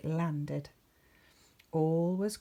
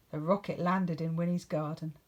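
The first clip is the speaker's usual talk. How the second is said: usual speaking voice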